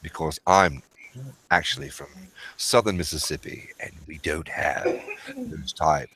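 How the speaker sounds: low, soft English-accented impression; Chris Collier. Shown as a demonstration. Man answering his friend in character, low soft English accent. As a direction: drop into a quiet, measured English gentleman. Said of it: "That right there would be Chris, Chris Collier".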